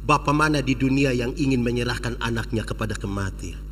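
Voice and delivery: put-on gentle father comforting his child; tender father. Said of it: "Sambil sapu-sapu di kepala".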